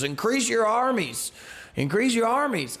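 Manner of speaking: put-on high, drunken call to arms; a tipsy boaster calling the crowd to arms; drunken rallying cry. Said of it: "I'm making that, like, voice up".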